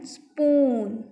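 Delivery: "स्पून" with a held oo; teacher drawing out the vowel in "स्पून"; long OO sound. Shown as a demonstration. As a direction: stretch "स्पून" into spooon. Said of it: "बड़े ऊ की sound".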